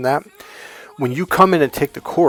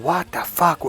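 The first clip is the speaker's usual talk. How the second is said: acted-out indignant disbelief; mock enraged; 6 semitones higher than usual. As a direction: imitate a fed-up man. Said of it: "You get enraged".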